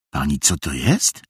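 low question with a wary rise; suspicious. A narrator's reading. Little brother questions the bundled visitor, suspicious. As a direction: ask it warily, holding back. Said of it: "zapytał podejrzliwym tonem Charles".

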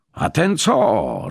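high, drawn-out outraged roar; angry roar. A narrator's reading. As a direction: bellow it slowly, livid with anger. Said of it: "ryknął pan Franciszek". (gniewny, przeciągły ryk)

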